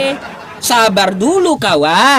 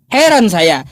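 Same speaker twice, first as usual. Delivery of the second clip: normal talking voice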